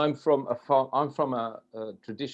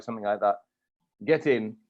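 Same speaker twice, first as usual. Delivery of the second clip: ordinary talk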